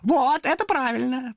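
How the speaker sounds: high, slow hoarse demon approval. A narrator's reading. Demon approves Thomas taking the axe. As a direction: rasp your approval. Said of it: "прохрипел демон".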